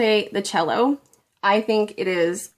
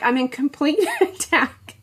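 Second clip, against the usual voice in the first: usual speaking voice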